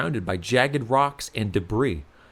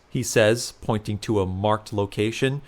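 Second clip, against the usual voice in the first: ordinary talk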